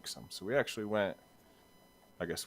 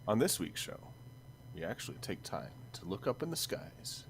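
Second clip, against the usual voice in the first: big podcast intro voice; show announcer; 5 semitones higher than usual. Acted. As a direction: announce the show like a radio host.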